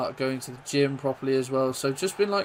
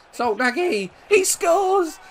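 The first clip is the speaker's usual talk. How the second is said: loud, high, drawn-out commentator's roar; excited goal shout; 9 semitones higher, 6 dB louder, slower than usual. Real, not acted. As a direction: belt out the goal as it goes in. Shouting as the player scores.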